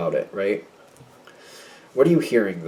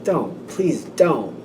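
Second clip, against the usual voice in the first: slow, drawn-out mock plea; pleading; 5 dB quieter, much slower than usual. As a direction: beg them off, stretching the words. Acted dread at their approach.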